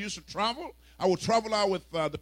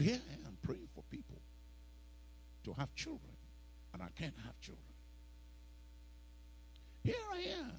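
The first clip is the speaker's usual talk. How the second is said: hushed lament; 13 dB quieter than usual. Retold or imitated. Retold private lament, very soft.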